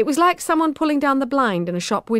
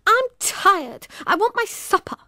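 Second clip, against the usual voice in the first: put-on whiny tired child; 13 semitones higher than usual. A narrator's reading.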